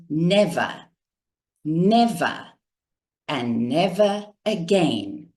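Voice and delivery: "Never", long pause, "never", long pause, "and never again"; measured oratory, each "never" falling and standing alone; speaking in cadence. Shown as a demonstration. Coach quoting Mandela's speech, slow cadence with pauses. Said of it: "He went".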